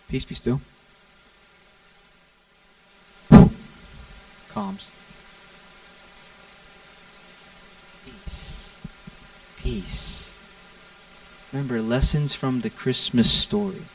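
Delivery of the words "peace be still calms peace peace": soft, hushed peace be still; calm command. Retold or imitated. Jesus quietly calms the storm from the boat. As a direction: breathe it out gently, stilling the storm. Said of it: "He wakes up and just goes".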